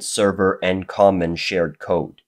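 ordinary delivery

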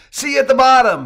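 high, loud holler; big shouted send-off; screamed farewell. A narrator's reading. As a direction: call it down the line.

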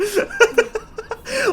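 high shrieking laugh. Real, not acted. Gamer cracks up at the game.

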